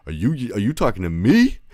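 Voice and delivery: mock tough-guy challenge; confrontational tone. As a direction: square up to her with the question. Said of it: "I said it in a tone like".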